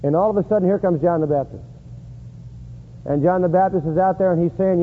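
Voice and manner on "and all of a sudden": ordinary talk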